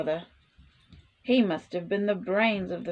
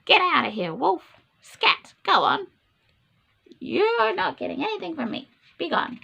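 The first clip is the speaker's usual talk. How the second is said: pitched-up pig brush-off; rude pig; 7 semitones higher than usual. Acted. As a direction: chase the wolf off with a snippy voice. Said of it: "Who's telling the real story?".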